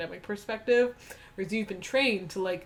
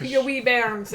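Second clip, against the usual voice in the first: mock Scottish accent; 4 semitones higher, 6 dB louder, much slower than usual. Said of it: "He was like".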